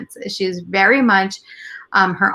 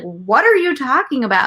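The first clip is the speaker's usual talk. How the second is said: higher-pitched, incredulous retort; retold parental disbelief; baffled scolding; 5 semitones higher than usual. Mother to older daughter in the car, incredulous.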